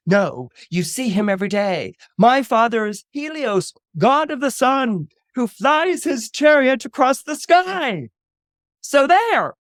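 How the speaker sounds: high, loud, building proclamation; indignant schoolboy showing them all; defiant boast. Acted. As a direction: declaim it grandly, climbing to a shout. Boy boasts defiantly to mocking schoolmates.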